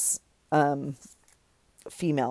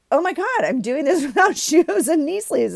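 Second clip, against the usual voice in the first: high, loud laughing panic; laughing oh my God, way up high; mock panic; 10 semitones higher, 5 dB louder than usual. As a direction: squeal it in comic horror. Panicked realization retold.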